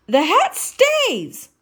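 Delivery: high, slow, loud Piggy snap; snapped. Acted. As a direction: bite it off, the hat is not negotiable. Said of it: "snapped Miss Piggy".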